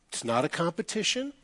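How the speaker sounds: soft, rising inner thought; thinking to himself. Retold or imitated. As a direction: murmur it like a private reminder. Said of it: "think to myself".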